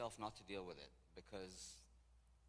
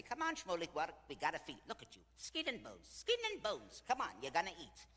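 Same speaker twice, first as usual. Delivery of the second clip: loud, high-pitched nagging to eat; 8 semitones higher, 8 dB louder than usual. Retold or imitated. Golden girls insisting their skinny tour guide eats, nagging.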